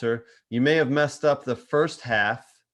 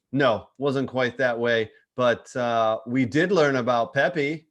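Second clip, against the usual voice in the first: ordinary talk, nothing marked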